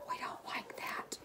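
soft whisper to the pup; comforting a shivering puppy in a whisper. Real, not acted. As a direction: hush it to him.

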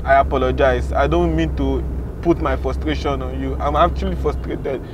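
low, subdued apology; sincere apology. Acted. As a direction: climb down and apologise calmly. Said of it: "I sincerely apologize".

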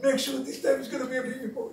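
softly pleading with God under the breath; a quiet, hopeful prayer; a wife's hushed prayer for a baby boy. Retold or imitated. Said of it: "She's praying to God".

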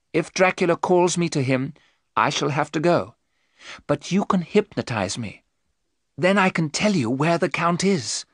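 high, earnest pleading explanation; earnest Mina. Acted. Mina asks the professor to hypnotize her, earnest.

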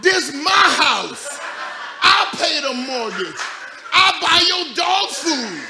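loud, slow shouted dog voice; dog barking at me. Retold or imitated. Dog barking at its owner, loud and slow. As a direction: bark it out like a bossy dog. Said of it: "she would start barking at me".

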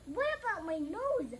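half-hearted scream from the upset wife; mild story scream. A narrator's reading. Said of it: "screamed the wife".

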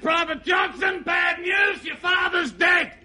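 high parade-ground shout; sergeant major. Acted. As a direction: shout bad news like a drill order. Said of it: "the sergeant major's out there".

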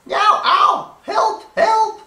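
very high, loud yelps of help; panicked cry for help. Acted. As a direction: shriek like a chased sheep. Sheep crying out, chased.

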